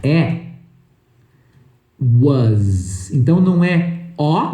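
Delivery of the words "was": WAS as "wuhz", low and drawn out; correct pronunciation. Shown as a demonstration. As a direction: buzz a long Z ending on WAS. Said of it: "A pronúncia correta".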